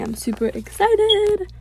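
high, drawn-out excited squeal; super excited. Real, not acted. Gamer awaiting pet eggs in the event, super excited.

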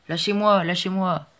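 high, loud cries to be released; boy struggling in the guard's grip, shouting. Acted. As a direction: yell while fighting free. Boy struggles against captor.

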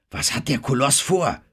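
aufgeregte Frage mit hoher Stimme; aufgeregt rufen. A narrator's reading. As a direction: frage alarmiert, was droht. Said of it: "rief Jimmy". (besorgter Zuruf)

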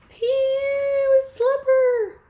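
high, loud, drawn-out yuck; mock disgust. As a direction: squeal at a stinky find. Mock disgust at smelly slipper.

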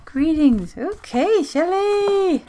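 high, loud excited greeting. Real, not acted. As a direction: announce it with a flourish. Reads card greeting with flourish.

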